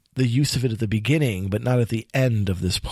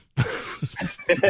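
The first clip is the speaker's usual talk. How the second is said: laughing; 8 semitones higher, 3 dB quieter than usual. Real, not acted. Laughing at medievalist habit.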